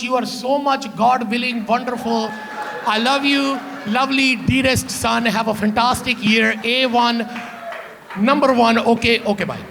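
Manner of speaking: put-on loving mom in a thick Punjabi accent; mom's Punjabi accent. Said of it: "your mom has a Punjabi accent".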